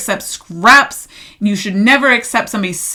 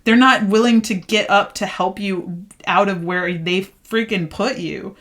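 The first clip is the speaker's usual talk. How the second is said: ordinary talk, nothing marked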